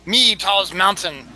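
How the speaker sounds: ordinary talk, nothing marked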